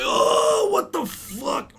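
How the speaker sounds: very high, loud drawn-out cry; hammed-up scream of disbelief, slowing on the words; overwhelmed shout. Student learning the exam will be marked correct, overwhelmed shout. Retold shock at lecturer's mercy.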